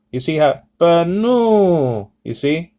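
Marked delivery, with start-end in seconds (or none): 0.8-2.1 "pneu" drawn out slowly, pitch rising on the long OO; "pneu" in slow motion with a swelling tone; raised tone. Shown as a demonstration. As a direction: stretch "pneu" as puh-NOOO to show the lift. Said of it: "You're rising it a little bit".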